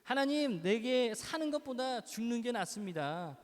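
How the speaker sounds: high, loud and slow cry to God; acted shout at God. Jonah shouting at God that he would rather die. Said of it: "하나님께 또 소리를 지릅니다".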